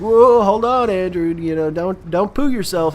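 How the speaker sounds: put-on teasing girlfriend. Girlfriend mocking him whenever he gets angry, light teasing. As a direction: playfully tease him to calm down. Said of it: "she'd be like".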